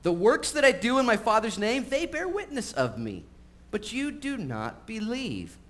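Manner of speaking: Jesus pleading with the doubters, impassioned; impassioned preaching. Acted. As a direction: preach it with fire. Preacher voicing Jesus' rebuke.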